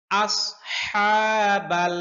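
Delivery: slow আসহাবাল with a heavy স and a pressed throat হা; Quran recitation demo. Shown as a demonstration. Teacher showing students the heavy সদ in আসহাবাল. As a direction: draw out আসহাবাল, the হা squeezed gently from mid-throat. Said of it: "কণ্ঠনালীর মধ্যখান থেকে হা".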